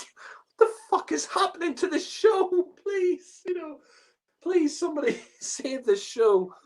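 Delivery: high, laughing exasperation; laughing despair at the show; laughing disbelief. Real, not acted. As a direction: plead through giggles for someone to save it. Ranting about the toddler alien scene.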